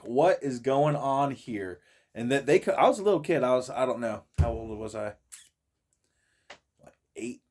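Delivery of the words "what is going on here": slow, bewildered. Retold or imitated. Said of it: "I'm like".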